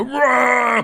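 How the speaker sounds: sudden loud high roar; angry roar. Retold or imitated. Road rage at a driver, loud angry roar. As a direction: bark out a raging yell. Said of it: "I might react quite quickly and go".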